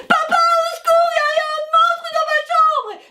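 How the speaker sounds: put-on high, loud child's scream; screaming for help. The girl screaming to her dad about a monster, high and loud. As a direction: shriek for Papa in terror. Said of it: "elle s'est mise à, à hurler".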